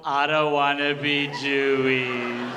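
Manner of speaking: slow, loud, sing-song mock sob; hammed-up tearful plea, drawn out; mock crying wail. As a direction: wail it like a kid bawling to mom. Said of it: "So I'm crying to my mom".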